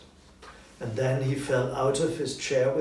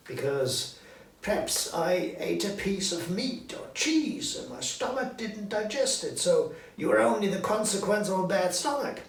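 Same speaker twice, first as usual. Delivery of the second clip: put-on higher, fussy old miser explaining away a ghost; nervous Scrooge; 9 semitones higher, 3 dB louder than usual. Scrooge to Marley's ghost, higher, nervous bluster. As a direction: talk yourself out of your fear, higher.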